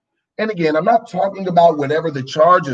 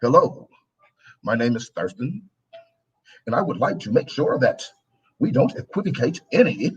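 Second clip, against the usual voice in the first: lower, softer, stiff proper diction; corporate voice; 6 semitones lower, 4 dB quieter than usual. Shown as a demonstration. Mocking how you must talk in the corporate world, stiff proper voice. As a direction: play it buttoned-up for the boardroom. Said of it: "go into the corporate world".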